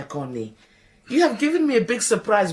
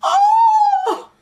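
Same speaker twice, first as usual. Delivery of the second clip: high loud anguished cry; wailing oh; 7 dB louder than usual. Acted. Maid to Ra, wailing over the hotel job. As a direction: wail in despair.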